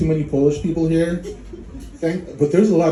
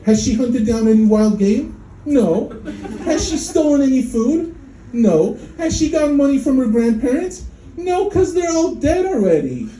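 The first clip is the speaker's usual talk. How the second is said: rising mock outrage; 9 semitones higher than usual. Mock checklist of kid's achievements.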